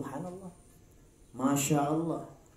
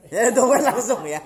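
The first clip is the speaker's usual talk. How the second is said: louder, laughing repeat; 6 semitones higher, 10 dB louder than usual. Real, not acted. Preacher echoes the listener's answer, laughing. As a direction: laugh as you echo the answer.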